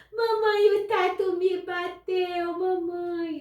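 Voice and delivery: put-on high, loud, slow wailing child; crying little girl. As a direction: wail it like a tearful little girl tattling. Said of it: "ela vinha chorando". (menina chorando alto, voz bem aguda e arrastada)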